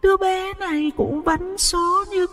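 very slow, high eerie taunt; gloating ghost. Acted. Ghost mocking the grieving family, slow and high. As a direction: savour each word like a spiteful spirit.

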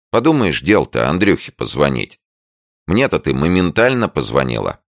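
high, loud scoffing. A narrator's reading. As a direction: brush it off, loud and lively. Tanya brushing off fears.

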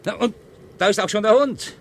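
höher und lauter, triumphierend mitfiebern; jubelnd, als ob die Jagd live gewonnen wird; aufgeregt. Acted. (aufgeregt jubelnd vor dem Fernseher)

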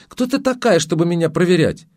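sharp high indignant challenge; a high, affronted counterattack; attacking. A narrator's reading. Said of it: "сама ринулась в атаку".

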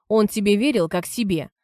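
usual speaking voice

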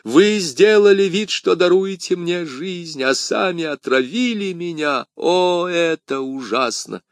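high wail of a dying man; high, agonised outcry, falling at the end; anguished accusing cry. A narrator's reading. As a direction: accuse your poisoner in agony. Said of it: "вскричал Бризмон".

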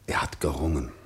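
whispered scornful echo; mocking whisper. Mother whispering his words back in scorn.

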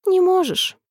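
высоко, с лёгкой грустью; протяни высоко и огорчённо; грустный вздох. A narrator's reading. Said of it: "грустно вздохнула королева". (грустно вздыхая)

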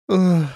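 high, loud "oh" of dismay; distressed moan. A narrator's reading. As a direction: let out an anguished oh. Distressed sigh amid Tora's lament. (горестный стон)

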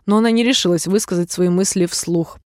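ordinary delivery

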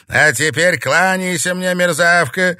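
loud, high, arrogant command; haughty. A narrator's reading. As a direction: lord it over a despised underling. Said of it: "надменно произнесла Маура".

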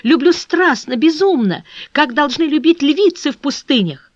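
loud, high, passionate declaration; crying out. A narrator's reading. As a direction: cry out the love with wild intensity. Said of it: "вскричала она".

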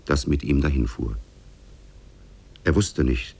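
usual speaking voice

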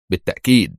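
firm one-word confirmation; stern. A narrator's reading. Director answering the indignant minister, stern. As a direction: answer firmly, with a falling close. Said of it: "أجابها في صرامة".

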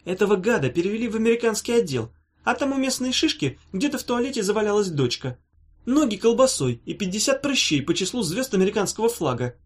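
high, venomous mimicry of an ex; mock him in a sneering high voice; a girl parroting her ex's excuses with venom; mocking impression. A narrator's reading. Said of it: "передразнила".

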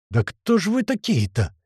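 hissed through teeth. A narrator's reading. Grach wonders who the thieves are, slow hiss. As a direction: draw it out slowly through the teeth. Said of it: "сквозь зубы прошипел Грач".

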